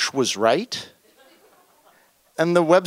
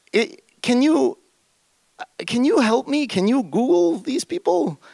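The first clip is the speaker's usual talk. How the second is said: high, urgent please-help voice; pleading; 10 semitones higher than usual. Retold or imitated. Narrator begging Dragan for help, pleading. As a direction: beg him to help, voice up high.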